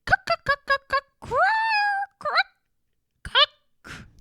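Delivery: very high, loud squirrel chatter; angry squirrel scolding. Acted. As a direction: squeal and scold like a furious squirrel. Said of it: "scold and shout".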